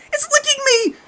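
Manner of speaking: piercing, extremely high shriek; scream of pure terror. A narrator's reading. Kathy feeling it lick her, screaming in terror. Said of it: "Kathy screamed again".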